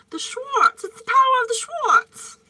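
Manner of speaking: high, loud, cracking up; laughing movie quote. Retold or imitated. Quoting Spaceballs to viewers, loud laughing. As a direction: blurt the line while laughing hard. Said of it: "I freaking love Spaceballs".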